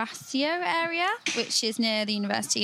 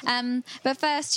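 ordinary talk

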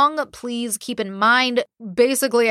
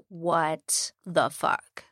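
very slow, softer, drawn-out disbelief; stunned disbelief; 4 semitones lower, 7 dB quieter, much slower than usual. Retold or imitated. People reacting to the party photos, slow stunned disbelief. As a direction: let each word hang in shock. Public's shocked reaction voiced.

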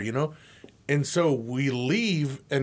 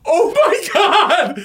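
laughing; 8 dB louder, much slower than usual. Retold or imitated. Quoted shock, laughing.

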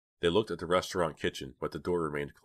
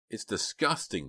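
slow, higher-pitched complaint; complaining; 5 semitones higher, slower than usual. A narrator's reading. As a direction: whine slowly in mild disgust. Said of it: "Dina complained".

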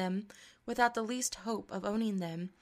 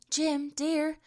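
high, louder call of his name; crying out; 7 semitones higher, 5 dB louder than usual. A narrator's reading. Della crying out to her husband, pleading. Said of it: "she cried".

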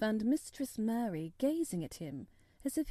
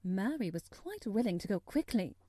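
ordinary delivery, nothing marked

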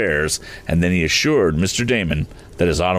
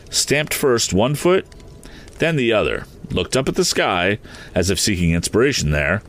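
ordinary delivery, nothing marked

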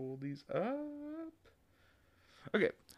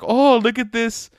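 car ad voice; 6 semitones higher, 4 dB louder, slower than usual. Pretend. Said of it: "I really wanted them to be like".

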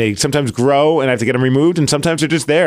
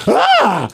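a mock scream, high and sharp; imitating wife's startled scream; retold scream; 11 semitones higher, 3 dB louder than usual. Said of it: "And she screams".

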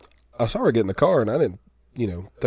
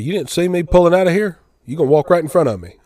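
higher, louder, fast indignant rant; angry scolding; 8 semitones higher, 8 dB louder than usual. Retold or imitated. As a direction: scold him for cutting in front. Driver scolding the narrator.

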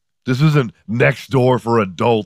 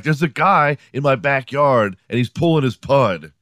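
higher-pitched mock police caller; 5 semitones higher than usual. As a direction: report it like an agitated caller. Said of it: "call the police and be like".

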